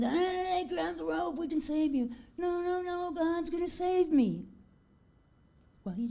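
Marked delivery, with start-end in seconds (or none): 0.0-2.1 higher mock yell; yelling from the helicopter. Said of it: "they're yelling". 2.4-4.5 higher, insistent mock refusal; stubborn refusal. Stubborn man refuses the helicopter.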